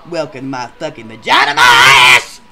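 bellowed disgust; loud punchline. Acted. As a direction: shout the kicker out. Angry closing punchline.

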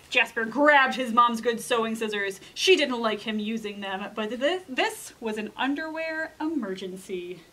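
lively picture-book reading with dramatic swings; storytime narration. A narrator's reading. Librarian reading a picture book to kids, storytime voice. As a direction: read it to a group of children, playing up the scary parts.

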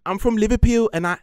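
high, loud mock Liverpool accent; Scouse accent. Asked for his best Scouse, he hams up a Liverpool accent. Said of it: "do your best Liverpudlian accent".

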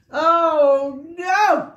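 high, loud, groaning Mister Bear; Mister Bear, fed up. Acted. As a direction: wail it like a sleepless bear at the end of his rope. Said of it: "said Mister Bear".

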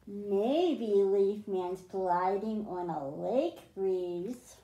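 put-on reading a picture book aloud to kids; storybook reading. A narrator's reading. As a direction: read it like a bedtime story for the class. Teacher reads picture book aloud.

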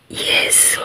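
usual speaking voice

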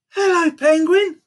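high, loud, slow storybook hello; excited greeting. A narrator's reading. Boy greeting the penguin in his present, excited.

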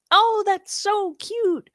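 high, slow, drawn-out coo at something adorable; cooing over cute clothes. Acted. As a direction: melt over a tiny outfit, stretching every word. Gushing over tiny kids' clothes.

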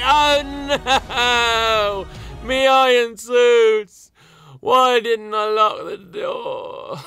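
high, loud panicked wail. Acted. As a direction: shriek in mock panic. Panics over his iron suits.

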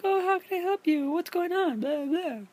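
high-pitched mock sweet nurse voice; lady nurse impression. Man mimicking the lady nurses offering help, high put-on voice. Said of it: "the lady nurses were like".

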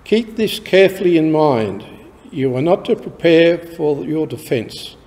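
ordinary talk, nothing marked

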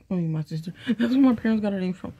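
ordinary talk, nothing marked

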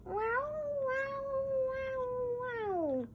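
high wailing wow like a siren; fire truck voice. Pretend. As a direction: sing it out like a little siren.

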